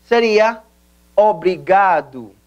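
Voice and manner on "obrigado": obrigado said slowly, o-bri-GA-du; pronouncing obrigado. Shown as a demonstration. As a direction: model obrigado for repeating. (pronunciación lenta de obrigado)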